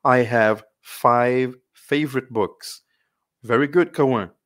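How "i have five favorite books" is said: slow, loud, careful English model sentence; slow model reading. Shown as a demonstration. Teacher reading a model sentence slowly to her students. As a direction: read it out slowly for the class to copy. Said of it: "Então".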